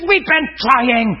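high, slow, drawn-out imitation of helplessness; mock desperate whine. Preacher imitating caller who failed at deliverance, mock desperate.

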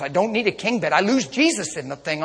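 ordinary talk

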